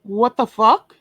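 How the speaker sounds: loud, incredulous what the fuck; loud outburst. Real, not acted. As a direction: blurt it out loud. Outraged outburst.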